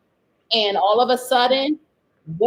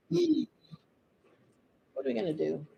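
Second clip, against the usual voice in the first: ordinary delivery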